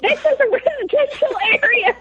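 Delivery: comic alarmed squeal at the driver; retold screaming. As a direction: protest in a squeaky pretend panic. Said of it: "I'm screaming".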